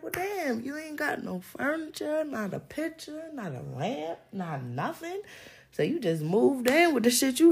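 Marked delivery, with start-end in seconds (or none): 0.0-5.2 quiet, mock disbelief. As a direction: say it like you can't believe the empty house. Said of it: "You be like".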